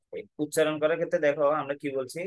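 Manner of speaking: ordinary talk, nothing marked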